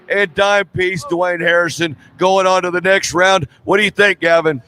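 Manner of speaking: excited drag race commentary; live play-by-play. Acted. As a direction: call the race as it happens.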